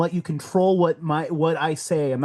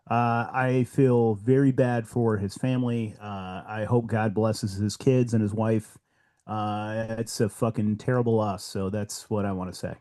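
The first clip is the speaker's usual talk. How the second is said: sombre condolences; 7 semitones lower than usual. Real, not acted. Condolences after a death.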